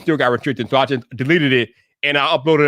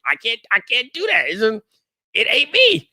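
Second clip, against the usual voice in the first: high-pitched, insistent refusal; exasperated protest; 7 semitones higher than usual. Real, not acted. Creator refusing to change his delivery, exasperated. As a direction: protest it with the voice climbing.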